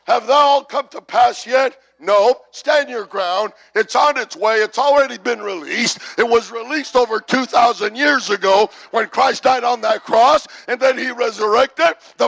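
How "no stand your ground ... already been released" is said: ordinary delivery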